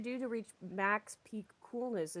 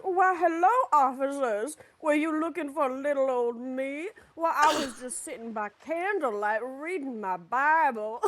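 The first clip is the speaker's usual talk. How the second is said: Southern belle; 6 semitones higher, 5 dB louder than usual. Retold or imitated. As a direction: put on a sweet Southern belle voice. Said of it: "turns into, like, a Southern belle".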